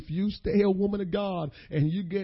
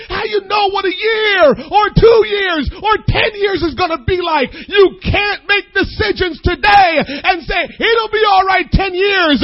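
loud, high preaching cry, building; preacher building to a shouted climax; preaching at full force; 9 semitones higher, 13 dB louder than usual. Acted. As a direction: whoop it to the congregation, much higher and louder.

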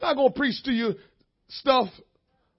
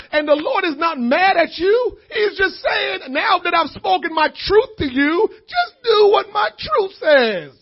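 loud, crying-out sermon delivery; full-force preaching; 7 semitones higher, 8 dB louder than usual. Acted. Preacher urging the church not to get mad at God, crying out. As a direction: preach hard, voice straining.

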